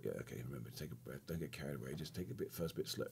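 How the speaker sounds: hushed reminder to yourself; inner voice. Retold or imitated. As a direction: quietly coach yourself before the plunge. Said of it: "I thought".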